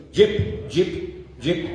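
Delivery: a clipped mock American "Jeep" said in a light gringo accent. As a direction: play the would-be gringo saying "Jeep". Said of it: "ya es que eres gringuillo".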